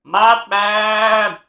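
high bleating cry of the name; goat-like Mothman call. Pretend. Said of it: "Why does he sound like a goat?".

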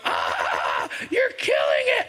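put-on high shrieked cry of a young girl; daughter's shriek. Daughter yelling at dad killing the mouse, shrill mock shriek. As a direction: shriek like a horrified nine-year-old. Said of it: "Piper's standing in the bathroom door going".

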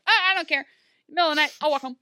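loud, high, defiant scoff; defiant brush-off. Retold or imitated. Emma storming off, loudly defiant. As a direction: toss it off like nothing can stop her.

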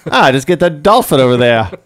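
a bad Australian accent, climbing high. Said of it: "your Australian accent sounds like a nineteen fifties male, like, lounge singer".

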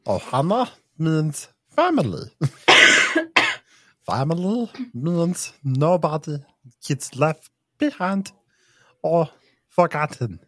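raspy little alien voice; growly cartoon alien creature; Stitch.

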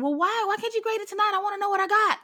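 high-pitched mock kid complaint; whining student. Student asking teacher for grades tonight, mock whine. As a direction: imitate an impatient pupil pleading up high.

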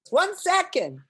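imitated impatient yell through a door; insistent stalling call. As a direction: holler to hold off a pestering visitor. George stalls again, more insistently.

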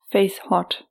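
usual speaking voice

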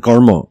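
"karma" said as Assamese "kormo"; Assamese pronunciation. Shown as a demonstration. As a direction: pronounce "karma" the Assamese way, with rounded o vowels. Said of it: "in Assamese".

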